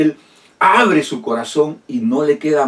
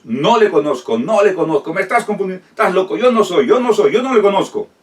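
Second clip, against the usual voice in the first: panicked Peter's denial; 6 semitones higher, faster than usual. Acted.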